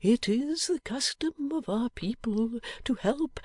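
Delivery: put-on mild, unhurried old man; gentle old man. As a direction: explain gently, drawing the words out. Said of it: "began the old man gently".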